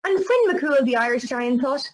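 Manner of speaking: ordinary talk, nothing marked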